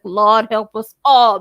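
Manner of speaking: loud, drawn-out cry to the Lord; exasperated plea. Retold or imitated. Host reacting to trouble brewing, loud exasperated plea. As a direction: stretch it out like a weary prayer.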